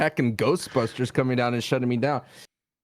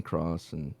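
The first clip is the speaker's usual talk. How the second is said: whispered aside; 12 semitones lower, 13 dB quieter than usual. Real, not acted. Trailing whispered joke.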